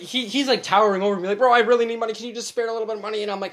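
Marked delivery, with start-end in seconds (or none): 1.4-3.2 high, loud imitated begging; retold urgent plea. Retelling the towering man asking for money, mock urgent plea. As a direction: press a stranger for money.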